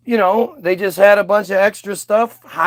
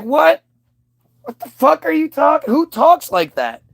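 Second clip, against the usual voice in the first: much higher, baffled and mocking; incredulous what; 8 semitones higher than usual. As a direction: pitch it up in disbelief. Incredulous reaction to fake talk.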